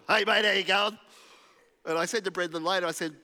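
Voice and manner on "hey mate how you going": put-on loud, matey Aussie tradie accent. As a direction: greet him like an Aussie builder on site. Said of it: "I generally talk like a tradie to 'em".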